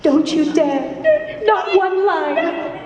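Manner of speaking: high, slow, drawn-out warning; dramatic quote. Acted. Fan acting out a favorite River Song line, dramatic warning.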